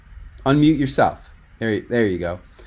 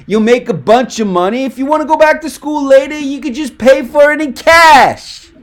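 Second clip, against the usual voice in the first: big, loud Italian pizza boss, much higher; pizza boss caricature; 9 semitones higher, 5 dB louder than usual. Shown as a demonstration. Coach demoing a pizza boss character to his student, hammed-up Italian caricature. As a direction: ham up the Italian pizza boss pushing Richie. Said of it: "that's a distinct character different than your own personal voice".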